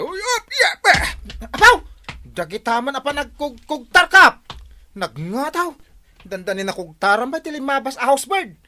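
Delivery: high, loud laughing shouts; excited yelling. Acted. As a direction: whoop at every kick. Cheering a kicking fight. (naragsak nga ikkis)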